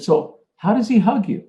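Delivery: gentle high question. Retold or imitated.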